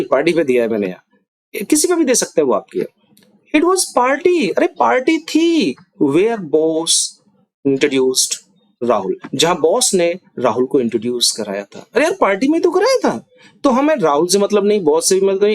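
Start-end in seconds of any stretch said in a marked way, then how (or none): 3.6-5.8 party said much higher and slowly; emphatic stress. Shown as a demonstration. Teacher shows emphasis on party to students. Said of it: "तो party पे हम emphasise कर रहे हैं". 11.9-13.3 much higher, insisting on party; but it was at the party, come on; exasperated emphasis. Shown as a demonstration. Teacher voices an insistent speaker. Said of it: "party पे हम emphasise कर रहे हैं".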